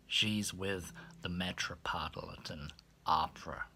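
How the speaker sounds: low, soft and sheepish; embarrassed hush. Retold or imitated. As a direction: answer wanting to vanish. Embarrassed reply to maid.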